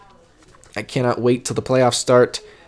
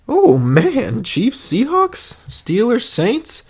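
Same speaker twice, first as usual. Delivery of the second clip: excited; 11 semitones higher, 3 dB louder, much slower than usual. Real, not acted. Excited reaction to schedule.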